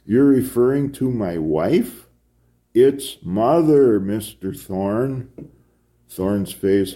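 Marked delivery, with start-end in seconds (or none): 0.1-2.1 louder, higher disbelieving question; incredulous question. A narrator's reading. Thorne questions priest about wife, incredulous. 2.7-5.3 MOTHER drawn out high and loud; emphatic insistence. A narrator's reading. Priest corrects Thorne, emphatic stress on MOTHER.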